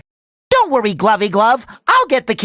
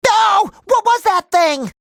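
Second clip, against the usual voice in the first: high-pitched alarmed outcry; startled fright; 7 semitones higher than usual. Acted. Hero spots some strange thing, high startled outcry. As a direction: yelp it as if something spooked you.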